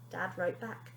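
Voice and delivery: usual speaking voice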